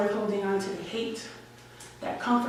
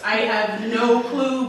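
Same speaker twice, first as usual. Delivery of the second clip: usual speaking voice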